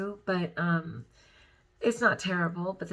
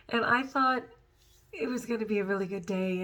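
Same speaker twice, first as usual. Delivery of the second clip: ordinary talk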